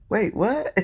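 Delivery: rising disbelief on what; wait, what, can't believe it; stunned double-take; incredulous. Real, not acted. Reacting to fan's room offer.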